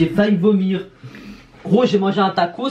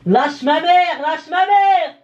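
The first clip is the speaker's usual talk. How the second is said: yelling; 6 semitones higher, slower than usual. Real, not acted. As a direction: shout at him to let go. Playful scuffle, yelling.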